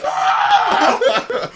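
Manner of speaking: high, loud mock shriek with a laugh; imitated shriek. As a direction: squeal it like she's startled. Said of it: "And she was like".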